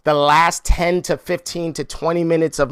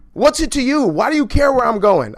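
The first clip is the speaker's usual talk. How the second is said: mock snappy homeowner; 8 semitones higher than usual. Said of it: "homeowner pushing back".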